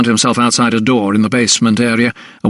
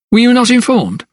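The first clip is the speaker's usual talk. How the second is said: much higher, questioning clerk voice; concerned clerk; 12 semitones higher than usual. A narrator's reading. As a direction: ask high-pitched, as a clerk taken aback. Clerk surprised doctor doesn't know.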